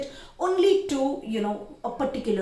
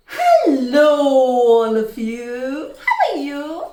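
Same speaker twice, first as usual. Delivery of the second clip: much louder, very slow stretched greeting; loud expressive greeting; 3 semitones higher, 12 dB louder, much slower than usual. Shown as a demonstration. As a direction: call out to wake the class. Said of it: "things like that".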